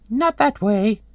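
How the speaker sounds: high bird warning. Acted. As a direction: warn him in a little bird's high voice. Bird warning Hellboy in story.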